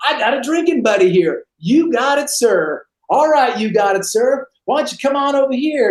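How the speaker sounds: mock the recruit to your buddy; mocking drill instructor. Drill instructor mocking the recruit to his partner, sarcastic.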